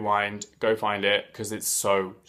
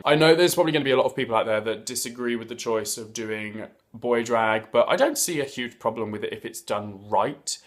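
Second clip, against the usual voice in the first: ordinary delivery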